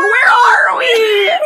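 high mock-hysterical wail; hammed-up, very high-pitched yell; panicked Andy. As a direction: cry it out in comic panic. Acting Andy's panicked exchange with Sam.

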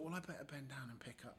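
soft, whispery dream narration; hushed hero voice. Retold or imitated. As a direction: whisper it like a rescuer on a mission. Hero thinking aloud in his dream.

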